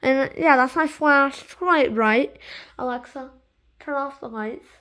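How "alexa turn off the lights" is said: clear, quiet command to Alexa; talking to voice assistant. Real, not acted. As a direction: speak a device command, softly. Commanding the smart speaker.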